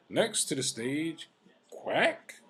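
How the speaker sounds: high, drawn-out announcer impression; stage host introduction. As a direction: introduce an act, name rising high and loud. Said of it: "the way he introduced me".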